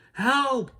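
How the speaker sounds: high, loud cry for help. Acted. Jack grabbed by the tall ninja, high loud cry for help. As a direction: yell it as you're grabbed. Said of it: "cried Jack".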